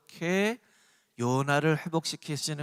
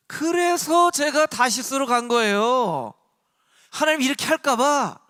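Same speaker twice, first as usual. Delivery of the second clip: loud, high acted complaint; slow, forceful self-justifying outburst; Jonah's indignant protest; 7 semitones higher, 7 dB louder than usual. Preacher as Jonah justifying his flight to Tarshish, indignant protest. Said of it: "어떻게 얘기했냐면".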